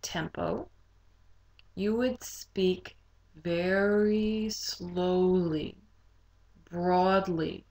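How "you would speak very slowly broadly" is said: drawn-out, broad speech; largo, very slow. Shown as a demonstration. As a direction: stretch every word out slowly. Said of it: "speak at a largo tempo".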